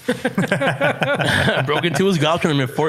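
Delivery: laughing through the words; repeating it with a laugh. Real, not acted. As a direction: chuckle it out, amused at himself.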